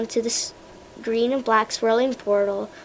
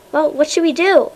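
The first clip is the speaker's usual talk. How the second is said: a touch of panic, higher and louder; panicked; 5 semitones higher, 6 dB louder than usual. A narrator's reading. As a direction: ask it in mild alarm. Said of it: "asks in a panicked voice".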